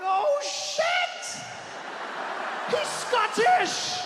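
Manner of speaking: shocked yell. Retold or imitated. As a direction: blurt it out in sudden disbelief. Shocked realization shouted.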